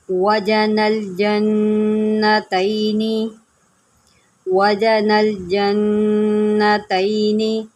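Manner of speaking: slow, drawn-out tajweed chanting; measured melodic Quranic recitation. Shown as a demonstration. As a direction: recite slowly, stretching each vowel. Recitation of verse.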